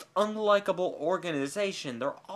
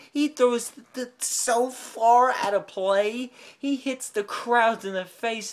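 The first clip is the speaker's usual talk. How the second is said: loud, raised, slowed mockery; worked-up mockery; 5 semitones higher, 5 dB louder than usual. Real, not acted. Fan mocking the Jaguars quarterback, loud and slow. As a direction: ham up how bad he throws.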